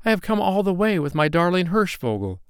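put-on high, earnest little-boy voice; high-pitched young storybook hero; pleading child. A narrator's reading. Child explains himself, pleading.

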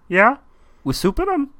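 high mock Kermit voice. Host retells Kermit asking about the soup bowls. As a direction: pitch way up like the frog, deadpan. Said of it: "And Kermit goes".

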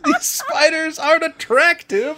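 high squeaky fake worry; hammed-up frightened high voice; mock fearful falsetto. Mocking the Arachnobonia fear.

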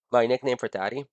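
usual speaking voice

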